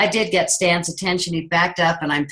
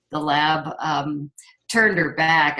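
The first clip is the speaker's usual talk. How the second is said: ordinary talk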